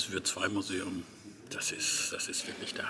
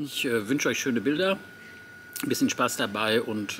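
loud and upbeat; cheerful farewell; 3 semitones higher, 7 dB louder than usual. Real, not acted. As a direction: send them off with energy.

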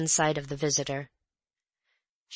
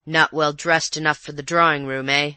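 louder gruff colonial voice; rough colonial; 6 dB louder than usual. Acted. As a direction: needle her bitterly.

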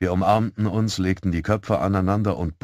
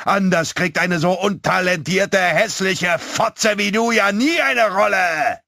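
put-on high, loud, jeering boy's yell; shouted abuse; 11 semitones higher, 6 dB louder, slower than usual. A narrator's reading. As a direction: keep yelling the insult, dragging out the end. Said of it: "rief er".

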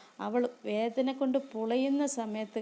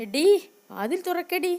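higher, louder shout at a locked door; calling through the door; 7 semitones higher, 9 dB louder than usual. Acted. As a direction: yell for her to open up. Sister bangs door, calls out.

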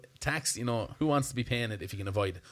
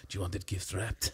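quiet, lower, creepy salesman voice; a hint of the odd shop assistant; 4 semitones lower, 7 dB quieter than usual. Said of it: "that scene in Love Actually where he's like".

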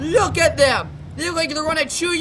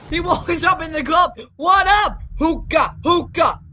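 club swagger; 3 semitones higher than usual. Acted. Voicing hero entering club.

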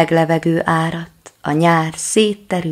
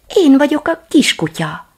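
little dog voice; 11 semitones higher, 6 dB louder than usual. Acted. Little dog introduces itself.

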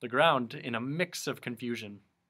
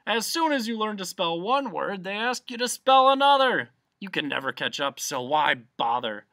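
animated, swelling pompous argument; blustering humbug; 5 semitones higher, 6 dB louder than usual. A narrator's reading. Humbug advising Milo against spelling, pompous bluster. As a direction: hold forth with raised, emphatic bluster.

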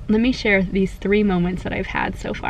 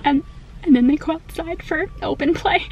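high-pitched mock crying voice; retold tearful; 7 semitones higher than usual. Mom re-enacting reading out her son's school schedule, mock-tearful. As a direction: act choked up, voice climbing high. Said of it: "trying to hold back the tears".

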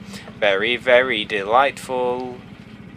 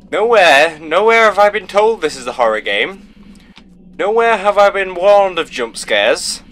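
building, louder, higher outraged complaint; indignant rant; 5 semitones higher, 4 dB louder than usual. Real, not acted. Player scolding the game after a jump scare, outraged. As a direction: scold the game, rising to a peak.

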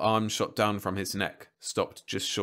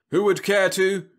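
smooth robot salesman; 11 semitones higher, 8 dB louder than usual. A narrator's reading. Robot continues pitch.

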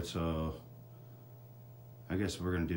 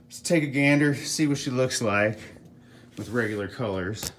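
breathless and pushed higher; out of breath; 5 semitones higher, 6 dB louder than usual. Real, not acted. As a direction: gasp it out after the stairs. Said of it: "I'm so out of breath".

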